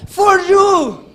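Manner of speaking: For you a gritos, for bien alto; For you gritado. Shown as a demonstration. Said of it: "En inglés, para que me entienda". (for you gritado en inglés)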